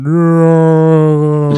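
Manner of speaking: long, loud drawn-out cry; dad's slow-motion yell. Retold or imitated. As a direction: bellow it high, as if in slow motion. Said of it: "I hear my dad going".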